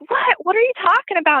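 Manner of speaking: high, fast startled what; shocked disbelief. Retold or imitated. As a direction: caught off guard, blurting out. Retold shock at friend's joke.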